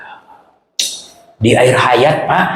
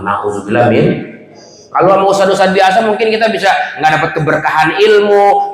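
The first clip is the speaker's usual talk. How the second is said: ordinary talk, nothing marked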